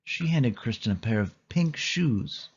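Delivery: usual speaking voice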